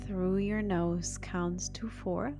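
hushed, slow meditation voice; soft spa whisper. Acted. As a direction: breathe the words softly, spa calm. Continues guided breathing.

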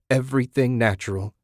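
plain reading voice

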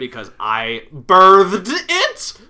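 loud, drawn-out indignant cry; mock outrage. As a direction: build to a high, slow, hammed-up shout. Mock outrage about naming her child.

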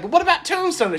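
high-pitched mock pleading; protesting odd one out. Lone Tombstone fan piping up, high mock plea. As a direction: pipe up high with a hopeful objection. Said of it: "I'm sitting here like".

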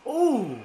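loud, high, drawn "ooh"; impressed ooh. Real, not acted. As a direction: react to a blow landing. Reacts to a hit.

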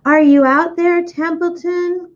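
calling out. Acted. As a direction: call out to the rat, stretching Templeton. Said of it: "called Wilbur".

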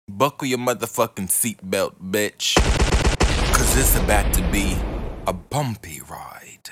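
fast, loud hype; fired-up villain threat; loud villain warning. Acted. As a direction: warn them loudly, rushing. Villain rant, warning listeners.

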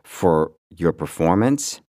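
normal talking voice

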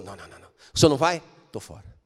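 Moses refusing to go alone, hushed; Moses backing out. Acted.

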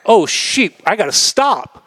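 a touch of high panic; mock panic. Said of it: "And I'm like".

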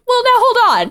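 high, laughing imitated protest; mock objection. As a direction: jump in with an amused objection. Acting Charlie and Dennis objecting.

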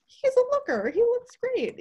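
swooning. Real, not acted. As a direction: swoon over a crush. Swooning over Robert Pattinson.

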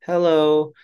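high, warm, committed greeting; mean it, but gently; gentle conviction hello. Shown as a demonstration. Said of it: "do it with conviction ... in a gentle way".

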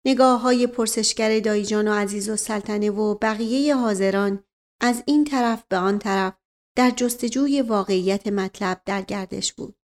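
usual speaking voice

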